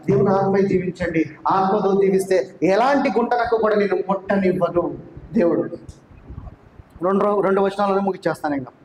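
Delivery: ordinary delivery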